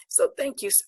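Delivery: real tears rising, the thanks cut short; getting choked up mid-thanks. Real, not acted. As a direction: voice catching and breaking off high. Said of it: "I'm getting choked up".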